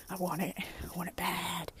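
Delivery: breathy whispered longing; whispered craving. Real, not acted. Vlogger craving a costume, whispered want. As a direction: hush it like a secret want.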